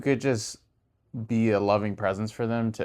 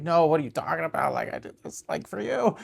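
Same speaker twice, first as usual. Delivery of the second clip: high, indignant protest; mock defensive retort; 7 semitones higher than usual. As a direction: whine back defensively. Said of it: "I would have gotten defensive".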